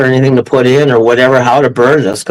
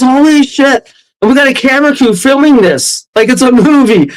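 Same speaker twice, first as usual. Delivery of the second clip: higher-pitched mock astonishment; retold shock; 8 semitones higher than usual. Rob reacts to the crew filming the chaos, retold shock. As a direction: exclaim it in disbelief, pitched up. Retelling Rob's shocked exclamation.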